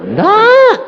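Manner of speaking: sudden high loud refusal; emphatic no. Retold or imitated. God flatly refusing the impatient preacher, high and loud. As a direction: snap out a sharp high no.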